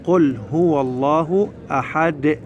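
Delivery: slow recitation, أحد ending in an audible bounced "de"; final dal bounced. Shown as a demonstration. Teacher shows children the stop on dal, bounced. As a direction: recite slowly and release the د of أحد clearly. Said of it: "هذا الدال يما نوقف عليه لازم نسمع".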